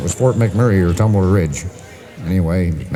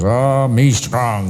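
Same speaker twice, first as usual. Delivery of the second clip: put-on slow, boastful Russian accent; Russian sailor; 4 semitones higher, much slower than usual. Russian sailor facing the lashes, slow boastful Russian accent. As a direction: draw it out higher, Russian tough-guy accent. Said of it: "And you, Russian".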